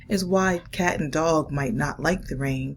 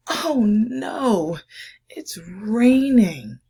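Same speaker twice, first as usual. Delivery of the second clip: higher, louder, slow wail of oh no; dog whining at the rain, higher and louder; dismayed dog; 6 semitones higher, 7 dB louder, much slower than usual. Acted. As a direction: moan it like a dog stuck indoors. Dog's dismayed line in story.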